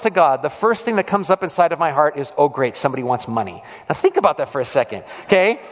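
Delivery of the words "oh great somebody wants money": low, muted retold grumble; mock weary annoyance. Preacher voicing his first thought about a stranger, wearily annoyed. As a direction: sink into a lightly cynical inner aside.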